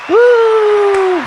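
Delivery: high, loud cheering woo. Real, not acted. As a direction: cheer like a crowd hype man. Cheering the guest in.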